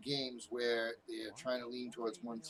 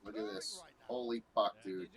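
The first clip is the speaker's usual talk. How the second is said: ordinary talk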